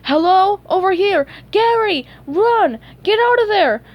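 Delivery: high, loud urgent yells; yelling. A narrator's reading. Two friends warning Gary through the window, yelling. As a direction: holler warnings in short bursts. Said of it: "yelling at the floating window".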